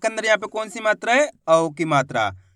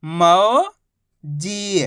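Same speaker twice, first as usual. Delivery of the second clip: मौजी split into मौ, long pause, जी; syllable by syllable; much slower than usual. Shown as a demonstration. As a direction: stretch मौ upward, pause, then a falling जी. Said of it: "तो मिलके बन जाएगा".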